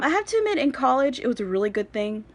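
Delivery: normal talking voice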